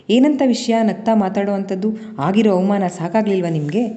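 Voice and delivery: plain reading voice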